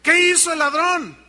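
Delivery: loud, high preacher's question; forceful rhetorical challenge. Real, not acted. Preacher asking church what the thief did, loud challenge. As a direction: throw the question hard at the congregation.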